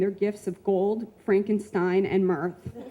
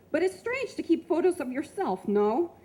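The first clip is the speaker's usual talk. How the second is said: put-on Russian accent; 9 semitones higher than usual. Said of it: "he said with a Russian accent".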